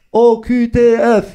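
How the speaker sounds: letters read out slowly. Retold or imitated. Lit les lettres chez l'ophtalmo.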